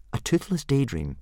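high, careful explanation; explaining. A narrator's reading. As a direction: explain it earnestly as a boy. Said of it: "explained Hiccup".

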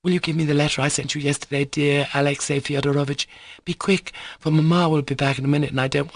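high, hurried request; anxious, rapid girl's voice; urgent young girl. Acted. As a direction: press him before Mamma returns. Lisa asks for her letter.

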